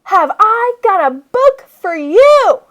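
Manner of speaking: loud, high and slow, drawing it out; big teacher's triumphant shout; excited yell. A narrator's reading. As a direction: announce it like a prize. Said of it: "Then she yelled".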